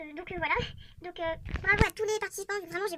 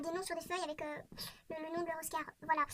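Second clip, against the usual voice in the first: ordinary talk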